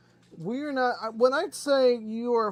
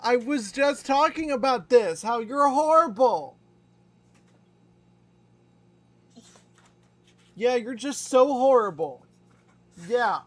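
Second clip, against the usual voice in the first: louder, higher teasing scold at the dog; playful loud scolding; 5 semitones higher, 9 dB louder than usual. Real, not acted. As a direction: tease the dog with an exaggerated mock scold.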